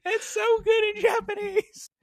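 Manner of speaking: high-pitched, helpless laughing; real laughter squeezing the words up high. Real, not acted. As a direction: crack up while talking. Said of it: "I'm just laughing".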